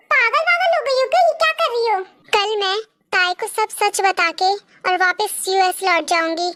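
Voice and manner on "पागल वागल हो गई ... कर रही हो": high-pitched, incredulous reproach; shocked scolding. Acted. Reacting to Lisa's plan to confess, shocked. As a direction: demand it in alarm, pitch jumping up.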